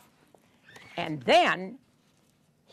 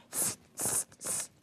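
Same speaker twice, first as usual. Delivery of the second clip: short unvoiced "sst" bursts; monkeys' hissing noise; 14 dB quieter than usual. A narrator's reading. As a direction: imitate monkeys with soft, separate hisses. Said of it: "stamped their feet and went".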